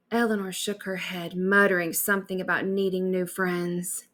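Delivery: ordinary delivery, nothing marked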